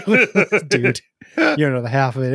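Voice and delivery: laughing through the words. Real, not acted. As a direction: chuckle it out. Boasting about the loud car.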